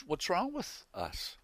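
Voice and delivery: normal talking voice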